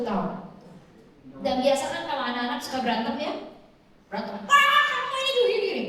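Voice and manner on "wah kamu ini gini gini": mock sibling quarrel.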